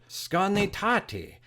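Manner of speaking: Skarnatati said slowly and carefully; Mohawk word. Shown as a demonstration. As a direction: spell out skar-na-TA-ti slowly. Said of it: "the Mohawk word".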